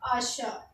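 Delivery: आशा said softly as a-SHA, the t silent; आशा with no t at the end; French word achat. Shown as a demonstration. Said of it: "क्या पढ़ेंगे?". (French उच्चारण में आशा, टी silent)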